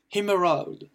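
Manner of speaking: emerald said with E as "i", "im-rald"; English pronunciation. Shown as a demonstration. Teacher models the word for learners, English pronunciation of emerald. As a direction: say emerald French-learner style, "im-e-rald", falling. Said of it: "le E qui se prononce I".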